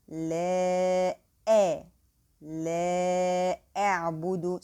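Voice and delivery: لا stretched, أ cut sharply, then لا أعبد in full; slow tajweed recitation with clear hamza; correct recitation. Shown as a demonstration. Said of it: "It should be".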